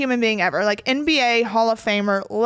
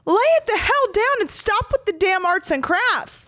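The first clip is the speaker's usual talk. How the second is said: higher, exasperated telling off; mock scolding; 7 semitones higher than usual. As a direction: snap at him to stop. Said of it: "And I finally said".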